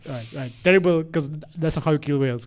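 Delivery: ordinary talk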